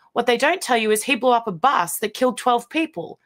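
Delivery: ordinary delivery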